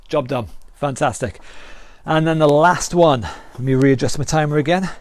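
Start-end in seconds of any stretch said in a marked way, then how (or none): none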